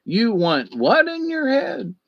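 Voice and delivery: you want WHAT in your head, shocked; appalled at the idea, pitch leaping high; incredulous. Real, not acted. Incredulous at brain implants.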